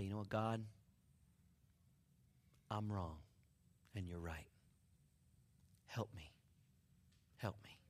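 low, soft plea to God; hushed prayer of repentance; humble prayer. Acted. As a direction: confess quietly, with long pauses.

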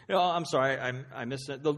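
ordinary talk

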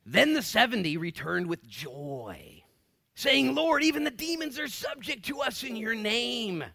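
high, loud, slow preaching read; fervent scripture reading. Acted. Preacher reading Luke aloud to church, fervent and loud. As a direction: proclaim the verse to the congregation.

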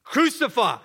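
high, loud crowd cry; loud, high demand for blood; mob shouting. Acted. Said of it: "but they kept shouting".

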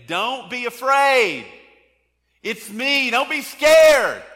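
high, loud, slow calling out; Jesus calling out over a storm, high, loud and drawn out; yelling across the storm. Acted. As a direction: shout it over the wind and waves so they hear. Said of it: "he's yelling to them".

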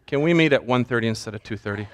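name, very long pause, then low hushed request; mock solemn prayer. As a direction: parody a prayerful silence before an everyday request. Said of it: "I certainly don't go like this".

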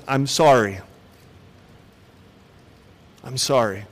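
soft, fading repeated apology; heartfelt apology. Shown as a demonstration. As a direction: let the apology trail off quietly. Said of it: "begins with an".

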